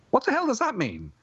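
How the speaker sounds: high-pitched exasperated outburst. Real, not acted. Podcast host baffled by a garbled film line, exasperated.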